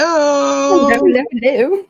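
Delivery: high, loud mock London hello; London accent. Co-host echoing the host's hello, mock London accent. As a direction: greet in a playful London accent. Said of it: "an accent, like you're from London".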